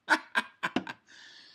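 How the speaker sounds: short burst of laughter; sudden chuckling at yourself; real laugh. Real, not acted. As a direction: laugh at your own slip. Laughs at already having one open.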